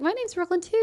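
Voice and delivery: high-pitched, sweet introduction; child-directed friendliness. Retold or imitated. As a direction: re-enact introducing yourself to a little kid. Retelling chat with a little girl.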